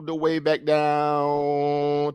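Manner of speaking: very slow, held vowels tracing a long drop; drawn-out words. Real, not acted. Stock's long fall, stretched out.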